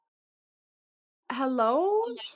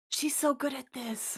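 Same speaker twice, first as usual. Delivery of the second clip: soft, confiding aside; hushed aside; 10 dB quieter, slower than usual. Acted. Val leans over to Quoth, hushed admiring aside. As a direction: lean in and say it much softer. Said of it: "Val leans over to Quoth and goes".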